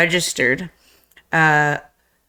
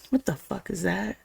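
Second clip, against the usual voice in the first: much softer, tense and hushed; hushed alarm; 11 dB quieter than usual. Retold or imitated. Gun pressed in tense scene.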